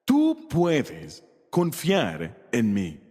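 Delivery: muy lento y suave, casi al oído; tierno y sereno. Acted. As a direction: dilo despacio, como consolando. Dios te habla, íntimo. (Dios tranquilizando al oyente, lento y tierno)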